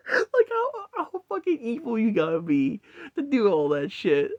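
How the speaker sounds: squeaky high giggling through the words; can barely get it out for laughing. Real, not acted. Laughing at the aliens wrecking everything, high giggly voice. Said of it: "just laughing at that".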